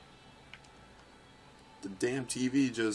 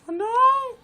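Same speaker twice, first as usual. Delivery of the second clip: very high, loud wail; panicked cry; 13 dB louder than usual. Real, not acted. Disaster in the race.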